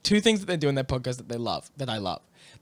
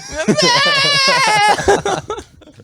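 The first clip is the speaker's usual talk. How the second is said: a shrieking, helpless cackle of laughter; high shrieking laugh; 9 dB louder than usual. Real, not acted. Cracking up at her own story, squealing laugh.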